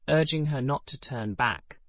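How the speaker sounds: plain reading voice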